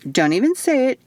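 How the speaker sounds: a hint of a playful friend cutting in; mock warning. A narrator's reading. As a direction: stop her teasingly. Isadora interrupts.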